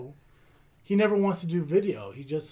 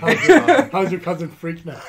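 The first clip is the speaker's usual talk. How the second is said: mocking imitation; 10 dB louder than usual. Mimicking host's question.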